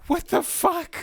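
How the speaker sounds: high disgusted outburst; grossed out. Real, not acted. Reacting to the movie in disgust.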